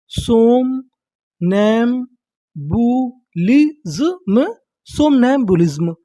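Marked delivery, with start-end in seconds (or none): 0.1-4.5 सोम, नैम, बू, लिज्म drawn out slowly with pauses; syllable by syllable. Shown as a demonstration. Teacher assembling a new word for learners, slow syllable by syllable. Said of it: "देखिए हमारी पूरी meaning क्या बनी".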